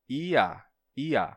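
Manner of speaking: Ia as two vowels i-a. Shown as a demonstration. Teacher demonstrating two-vowel ia. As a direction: split Ia into i and a. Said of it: "você tem vogal".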